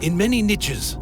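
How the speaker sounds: usual speaking voice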